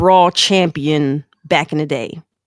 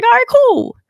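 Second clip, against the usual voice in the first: high, offhand "all right, cool"; mock casual reply. Answering mom's game invite with mock casual cool. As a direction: toss it off lightly. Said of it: "It's like".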